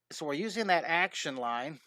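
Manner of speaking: usual speaking voice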